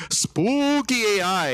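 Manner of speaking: high, slow hammed-up spooky tone; spooky voice to beg for funding; mock spooky voice. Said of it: "you wanna say".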